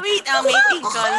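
high loud mating call; surprised. Real, not acted. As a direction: blurt it out in surprise. Repeating mating call loudly.

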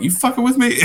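very high-pitched mock disbelief; incredulous. Podcaster retelling his reply to the guest's prank, incredulous. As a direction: leap up high in comic disbelief.

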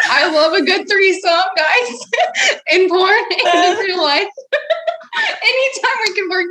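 giggling through the words; laughing while talking. Real, not acted. Laughing through threesome joke.

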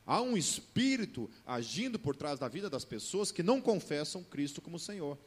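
loud fervent preaching voice; pregação inflamada. Acted. Preaching at full force. (pregação em voz alta e fervorosa)

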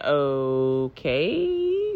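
a low, soft okay, slowly rising; drawn-out okay. Retold or imitated. To the boyfriend, a long doubtful okay. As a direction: stretch the okay out, baffled. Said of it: "That's literally how I said it".

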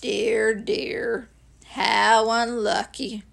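very slow donkey voice; ponderous, downcast sympathy; glum Eeyore. Acted. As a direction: commiserate dolefully, dragging the words.

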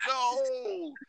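high, long-drawn "no"; mock horrified wail. Man reacts to toilet splash, mock horrified wail. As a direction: wail it in hammed-up slow motion. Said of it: "That splash happen like".

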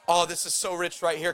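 normal talking voice

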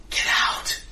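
hissed ghostly whisper in the ear; demonic whisper. Acted. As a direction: whisper it like a voice right behind them. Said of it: "you hear a hissing sort of whisper".

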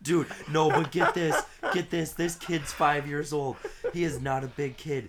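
high and loud, laughing as he talks; excited laughter. Real, not acted. Telling friends the gross story, loud and laughing. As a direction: push through the giggles.

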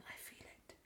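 soft whispered aside. Real, not acted. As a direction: breathe it as a secret. Whispered aside about the universe.

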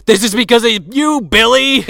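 mock crying. Mock crying John Saxon.

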